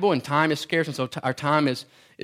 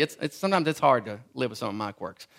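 ordinary delivery

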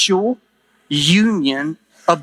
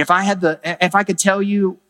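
usual speaking voice

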